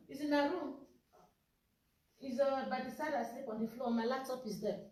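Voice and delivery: soft off-mic directions; quiet aside. Real, not acted.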